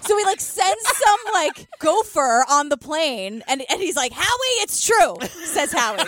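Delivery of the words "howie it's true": high, dramatic mock revelation; Howie's phone-call retelling. Journalist phoning Howie with the news, hammed-up revelation. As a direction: play up the big reveal, pitched up. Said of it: "That's Howie's interpretation of the phone call".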